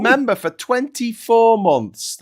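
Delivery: loud, high announcer shout-out. Acted. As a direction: boom it like a show host. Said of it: "announcing member shout-out".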